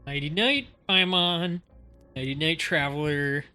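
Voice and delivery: normal talking voice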